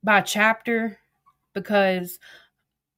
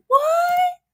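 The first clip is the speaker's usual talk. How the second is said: high, loud shocked "What?"; shocked shriek; 3 dB louder than usual. Retold or imitated. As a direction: squeal the word in disbelief. Shocked reaction to a username.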